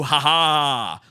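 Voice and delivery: hammed-up loud ha ha. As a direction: bark out a mock laugh. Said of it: "my new impression of [name]".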